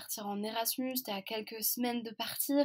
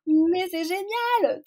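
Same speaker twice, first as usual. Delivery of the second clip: much higher, much louder, slow joyful cry; overjoyed; 10 semitones higher, 10 dB louder, much slower than usual. Retold or imitated. Grandmother hearing the pregnancy news, much higher, louder and overjoyed. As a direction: burst out with delight.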